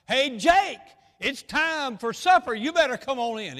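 put-on high, loud holler calling a boy in to supper; hollering sister. Sister calls Jake in from the porch, hollering loud and high. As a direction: belt it out like a sister at the back porch. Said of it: "she hollered out".

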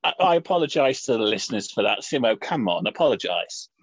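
low-pitched, rueful telling-off; sober, low reproach; reproving. Real, not acted. Apologising, scolding co-host for rudeness.

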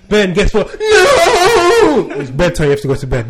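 loud, mock-gleeful mum with a high stretched No; mum announcing bedtime. Mum gleefully telling her son it's bedtime, mock-triumphant and loud. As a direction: tease the kid like a delighted mum. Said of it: "mum would be like".

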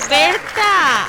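excited shout. Real, not acted. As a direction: welcome her on air with a shout. Cheering guest on air.

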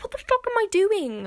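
high, loud exasperated cry; self-mocking outburst. Woman catching herself crying, high loud outburst. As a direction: blurt it out, appalled at yourself. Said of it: "And then I was like".

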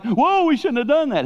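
very high, loud backpedal; mock alarm. Preacher imitating attackers who learn Paul is Roman, mock alarm. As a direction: yelp it in exaggerated regret.

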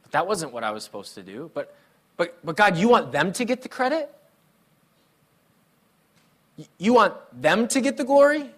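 mock complaint to God about the plan; complaining to God. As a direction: whine at God like a let-down child.

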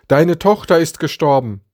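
lauter, höher zugerufene Nachricht; zugerufen. A narrator's reading. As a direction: ruf es über Distanz herüber. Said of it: "rief Jairus zu". (lauter Zuruf einer Todesnachricht)

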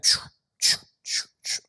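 unvoiced choo-choo of a train; whispered train noise. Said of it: "I was like".

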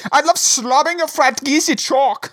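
put-on German accent. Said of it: "with German accents in mind".

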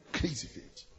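hushed whisper of "Crazy faith". Real, not acted. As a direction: breathe it out in awe. Repeated, second take whispered.

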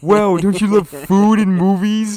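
high, loud mocking whine; mock him in a squeaky nagging voice. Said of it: "Matt's been talking about, like".

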